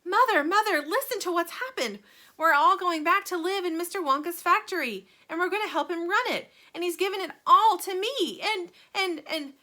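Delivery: high, loud, breathless tumbling words; excited child. A narrator's reading. Charlie telling his mother the news, high excited chatter. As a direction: spill it all out in a rush of excitement.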